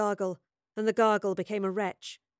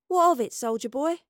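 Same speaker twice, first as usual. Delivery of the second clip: higher, louder taunting question; defiant challenge; 5 semitones higher, 5 dB louder than usual. A narrator's reading. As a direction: throw it back as a challenge. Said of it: "she said defiantly".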